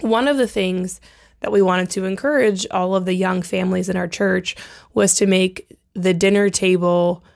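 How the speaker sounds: ordinary talk, nothing marked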